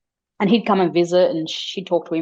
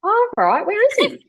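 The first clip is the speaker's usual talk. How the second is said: much higher and louder, caught off guard; startled mum; 5 dB louder than usual. Retold or imitated. Mum startled that her child sees a ghost, high surprised squeak. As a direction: squeak it out, half alarmed. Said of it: "And I'm like".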